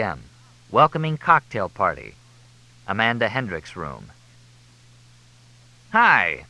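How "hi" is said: bright, breezy hello with a touch of extra volume; cheerful. A narrator's reading. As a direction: Fletch bluffing his way in, greeting the operators cheerfully. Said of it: "Fletch said cheerfully".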